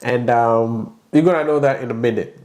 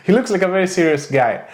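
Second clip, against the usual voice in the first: chuckling through the words, pitched up; laughing; 6 semitones higher than usual. Real, not acted. Host laughing as he introduces his serious-looking friend. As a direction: tease him while laughing.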